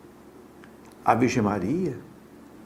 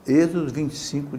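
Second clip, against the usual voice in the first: ordinary talk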